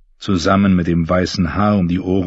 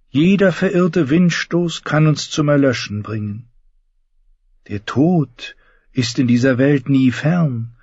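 Lancel; 8 semitones higher than usual. Acted. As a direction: preach the gods' gifts gently. Lancel's voice in the narration.

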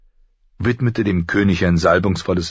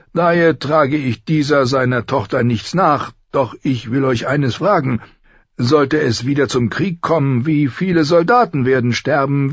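put-on old maester; 8 semitones higher than usual. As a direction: speak like a weary ancient maester. Pycelle advises the council. (alter Großmeister, höher)

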